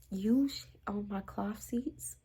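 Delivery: hushed incredulous question; whispered indignation; whispered outrage. Retold or imitated. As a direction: mouth it in disbelief. Indignant disbelief.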